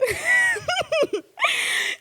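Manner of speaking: high shrieking laugh; loud laugh. Real, not acted. Vlogger introducing funny holiday stories, loud laugh. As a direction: burst out in a loud, high cackle.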